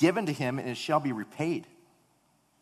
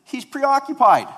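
put-on smug kid voice; 12 dB louder than usual. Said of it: "And Jonathan said".

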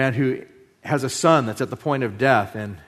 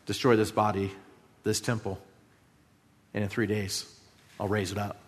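hushed quote; 5 semitones lower, 9 dB quieter than usual. Retold or imitated. Quoting Jesus in sermon.

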